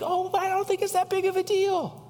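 a breezy no-big-deal inner voice; dismissive thought. Acted. Preacher voicing the listener's careless thought, lightly dismissive. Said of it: "We can think".

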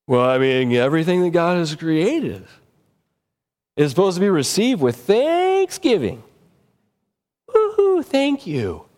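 mocking a careless partier; mock drug abuser excuse. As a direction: ham up the flimsy excuse, then cheer. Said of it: "I've actually heard people".

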